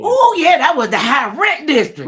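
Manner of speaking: loud, high-pitched ribbing; teasing exclamation. Real, not acted. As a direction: burst in high and loud to tease. Listener teases about neighborhood.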